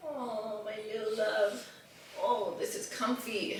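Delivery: soft cuddly pet talk. Real, not acted. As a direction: murmur lovingly to the dog while cuddling.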